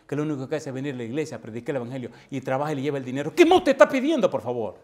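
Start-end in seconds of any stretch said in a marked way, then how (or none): 3.3-4.8 high, loud indignant cry; indignant outcry. Retold or imitated. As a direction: cry out in disbelief at the demand. Indignant rhetorical question. (grito indignado, agudo y fuerte)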